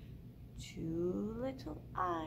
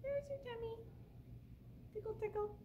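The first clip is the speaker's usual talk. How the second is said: put-on soft baby talk; 8 semitones higher, 10 dB quieter, faster than usual. Tickling the baby.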